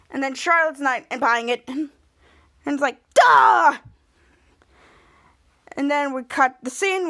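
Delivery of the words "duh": loud, high blurted exclamation; mock yell. Retelling a character's outburst in the scene, loud yell. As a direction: yell it out in one burst.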